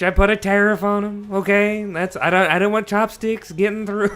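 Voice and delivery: country Trump. Pretend. Banning chopsticks as a hammed-up country Trump. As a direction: play a country-fried Trump for laughs. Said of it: "This is like a country Trump".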